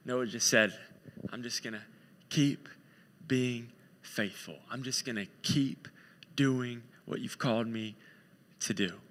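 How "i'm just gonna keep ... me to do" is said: soft, low, broken by pauses; hushed, deliberate steadfastness; quiet resolve. Acted. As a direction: vow it humbly, a few words at a time. Acting Noah's quiet faithful resolve.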